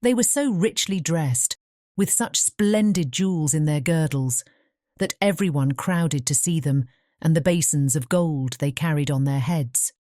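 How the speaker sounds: plain reading voice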